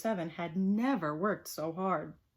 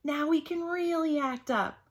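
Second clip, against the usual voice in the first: put-on higher, louder gleeful kids; mock yell; 8 semitones higher, 5 dB louder than usual. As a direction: cheer like naughty kids. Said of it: "yelled the kids".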